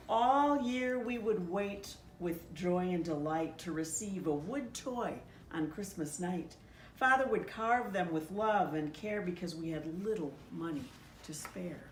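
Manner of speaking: ordinary delivery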